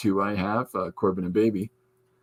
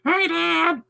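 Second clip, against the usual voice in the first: very high squeaky cat greeting; intermediate cat voice. Retold or imitated. Second orange cat greets its dad, squeaky. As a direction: squeal it high, between scratchy and clear. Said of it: "then there's an intermediate".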